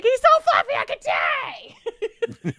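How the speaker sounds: high, loud, drawn-out squeal over the cat; hammed-up cat-lover squeal; gushing squeal. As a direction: squeal like you could die of cuteness. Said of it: "the two of us are like".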